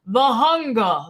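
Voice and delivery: a hint of a Transylvanian vampire accent; lightly voiced Transylvanian vampire, imitating. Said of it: "stereotypical vampirian vampire accent".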